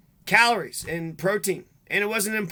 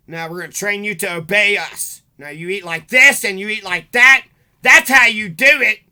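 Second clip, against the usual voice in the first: mock drill orders; 11 dB louder than usual. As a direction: bark it like a mocking drill sergeant training a dog. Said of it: "'cause it was like they're training".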